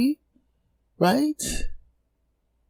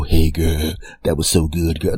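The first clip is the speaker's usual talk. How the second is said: a lightly put-on gushing friend voice, lower; mock sappy pal; 12 semitones lower than usual. Comic mocking friends who gush, a touch put on. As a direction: tease the sweet talk he never had.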